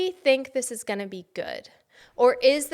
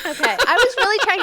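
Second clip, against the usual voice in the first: laughing; 10 semitones higher, 9 dB louder, much faster than usual. Real, not acted. Laughing after flubbed read.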